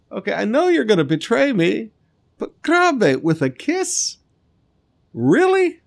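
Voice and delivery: high, incredulous teasing of a betrayer; playfully shocked, pitch climbing to Really; mock disbelief. Jesus to Judas, amused mock disbelief.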